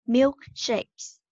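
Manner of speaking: Milkshakes joined up as one word. Shown as a demonstration. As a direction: run Milkshakes together without a pause. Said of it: "mình đọc nhanh hơn một chút nha".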